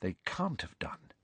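hushed, near-whispered denial; low voice. A narrator's reading. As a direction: drop right down to a whisper. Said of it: "said Hermione in a low voice".

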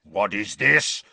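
growled. A narrator's reading. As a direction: menace in a rumbling growl. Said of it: "growled Uncle Vernon".

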